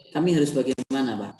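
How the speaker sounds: ordinary talk, nothing marked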